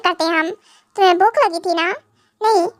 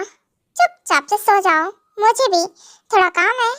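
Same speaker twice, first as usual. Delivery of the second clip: usual speaking voice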